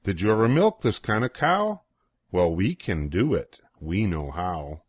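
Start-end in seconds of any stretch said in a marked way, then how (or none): none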